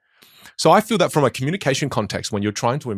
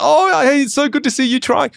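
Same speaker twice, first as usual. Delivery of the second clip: much higher, louder delighted welcome; delighted partner; 6 dB louder than usual. Pretend. Wife greeting her husband who is trying a new voice. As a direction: gush with pleased encouragement. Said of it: "And she was like".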